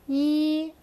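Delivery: "Yi" held high and even; first tone. Shown as a demonstration. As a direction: sustain "Yi" on one level pitch. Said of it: "first one is a horizontal bar".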